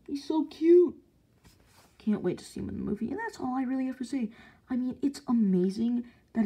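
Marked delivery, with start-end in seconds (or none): 0.1-1.0 high, loud squeal; cute squeal. Real, not acted. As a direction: coo over it. Gushing over figure.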